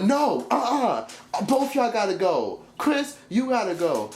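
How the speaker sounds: high, slow bossy mimicry; mocking Bianca. Vlogger mimicking Bianca kicking the guests out, high and bossy. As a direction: send them out in a high, slow sassy voice. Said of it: "When Bianca was like".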